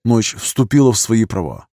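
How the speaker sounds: ordinary delivery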